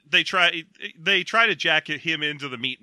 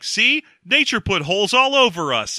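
higher and louder, proudly showing off; mock eager showing; 6 semitones higher, 5 dB louder than usual. A woman proudly showing her open mouth, mock eager. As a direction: point it out like it's obvious. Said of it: "and she's like".

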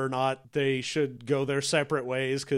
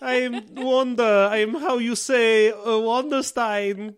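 slow, higher, hammed-up Eastern European accent; mock Eastern European accent; 6 semitones higher, 4 dB louder, slower than usual. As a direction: ham up a vague Eastern European accent as Wanda. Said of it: "Please don't give me vaguely Eastern European accent".

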